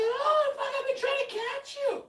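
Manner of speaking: very high, loud panting; breathless neighbor. Retold or imitated. Winded neighbor catching up to him, very high and panting. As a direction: gasp it out, winded. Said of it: "She's like".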